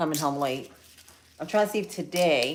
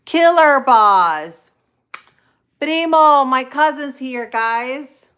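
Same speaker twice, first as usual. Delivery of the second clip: excited greeting; 6 semitones higher, 10 dB louder, slower than usual. Real, not acted. Greeting arriving chat viewers.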